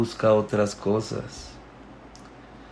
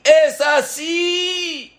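even higher, slow shouted correction; rabbi shouting; much slower than usual. Acted. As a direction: yell out how it should be done. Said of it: "Y empezó a gritar". (el rabino grita cómo se hace, muy agudo y lento)

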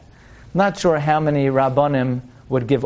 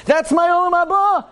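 "my Olam Haba" leaping high; gleeful claim; 12 semitones higher than usual. Acted. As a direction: crow over your windfall. Butcher claiming his prize.

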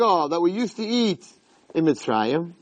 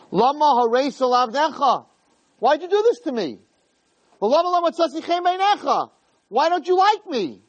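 high-pitched, pleading acted complaint; anguished protest; 8 semitones higher than usual. Moshe protesting to Hashem, anguished and high. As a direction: plead in an aggrieved, raised pitch.

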